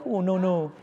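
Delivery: lightly voiced shy British groom; bashful British groom. Shy groom politely declines the ring at his wedding, lightly imitated bashful British voice. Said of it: "oh, he's British".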